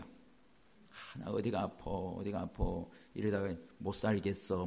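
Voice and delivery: soft, low whiny moaning about aches; mock complaining elder. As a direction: grumble like an old neighbor. Imitating elders bragging about illness. (나이 든 이웃이 아프다고 푸념하듯)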